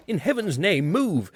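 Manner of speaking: high, urgent earnest plea. A narrator's reading. Friend urging the man to leave the chair, earnest. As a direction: beg him to move before it is too late. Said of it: "said the one I knew very earnestly".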